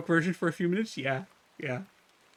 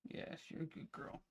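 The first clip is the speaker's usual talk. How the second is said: gentle, much softer voice; soft pet talk; 2 semitones higher, 9 dB quieter than usual. Real, not acted. Owner comforting her sick cat in a soft hushed voice.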